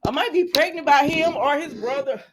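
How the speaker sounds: character fed up with two brothers; exasperated complaint. Retold or imitated. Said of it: "she said".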